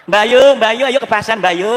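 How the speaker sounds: high, loud call to a neighbour. Acted. Showing-off neighbour inviting another to market, calling out. Boasting neighbour calls out in story.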